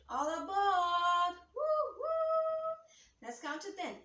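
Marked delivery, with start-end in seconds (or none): 0.1-1.3 loud, slow sung-out "All aboard"; train conductor call. Pretend. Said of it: "we're gonna pretend we're a choo-choo train". 1.5-3.2 high sung train whistle hoots. Pretend. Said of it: "pretend we're a choo-choo train".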